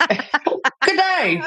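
high-pitched stab at an Australian accent; light, hammed-up Australian greeting; attempted Australian accent. Shown as a demonstration. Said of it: "in an Australian accent".

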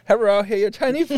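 put-on high, loud Chinese-accented delivery man; mock Chinese accent. Delivery man at the door, mock Chinese accent.

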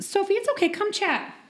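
high-pitched pet-coaxing voice; sweet-talking the cat. Real, not acted. Coaxing a shy pet over.

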